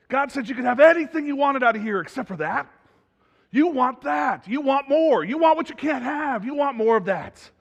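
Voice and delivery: high, loud, insistent serpent voiced by a preacher; tempting serpent. Serpent tempting Adam and Eve in the garden, insistent. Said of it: "the serpent shows up and goes".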